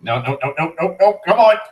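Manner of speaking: real panic climbing high and loud, no no no; panicked rising no's. Real, not acted. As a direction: voice shooting up in desperation as the boss closes in. Boss about to kill him.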